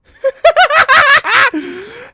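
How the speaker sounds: high, loud burst of laughter; long shrieking laugh. Acted. Claire bursts out at the bag disaster, loud laugh.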